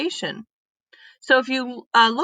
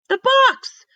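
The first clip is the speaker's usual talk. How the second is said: much higher, louder exclamation; realisation; 6 dB louder than usual. Acted. Annie realises the biggest clue, much higher and louder. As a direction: it finally clicks.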